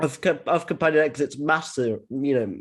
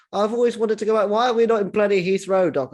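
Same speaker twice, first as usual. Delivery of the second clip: higher, louder attempt at an Australian accent; a failed try at Tegan's Australian accent; bad Australian accent; 6 semitones higher, 4 dB louder than usual. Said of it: "I can't do an Australian accent".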